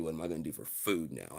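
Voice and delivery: normal talking voice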